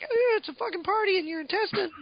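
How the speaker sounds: high, put-on party voice for the worms; squeaky little partygoer voice; partying parasite voice. Parasites voiced cheering about a party in the gut, high and put-on.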